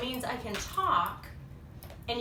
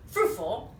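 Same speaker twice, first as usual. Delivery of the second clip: plain reading voice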